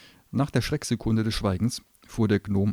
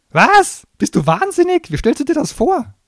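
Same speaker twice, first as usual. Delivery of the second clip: very high, loud outburst; appalled gnome; 14 dB louder than usual. Acted. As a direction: shriek in disbelief. Gnome aghast.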